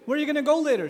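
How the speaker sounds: loud, high, insistent question; father pressing his teenager, loud; pressing dad. Acted. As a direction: keep firing questions at him.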